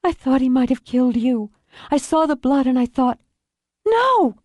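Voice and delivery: put-on high, distressed young woman's voice; shocked daughter. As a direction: build to a high, horrified cry. Daughter in shock after attack.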